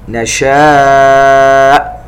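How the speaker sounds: slow, elongated tajweed chanting; Quran recitation. Shown as a demonstration. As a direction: recite it slowly and melodically. Recitation demo. (تلاوة مجودة بطيئة)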